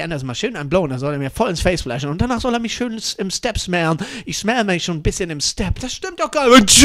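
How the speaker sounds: ordinary talk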